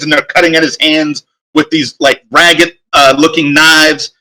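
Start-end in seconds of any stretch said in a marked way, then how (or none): none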